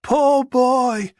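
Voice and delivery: drawn-out mock pity; sneering fake sympathy, word by word. A narrator's reading. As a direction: taunt with slow, separated words, swooping up on the last. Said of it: "his voice sliding into a mocking approximation".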